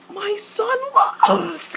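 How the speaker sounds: high-pitched, impassioned cry; gushing parent. Acted. Parent talking of the man the son loves, gushing. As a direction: lift it very high, overcome.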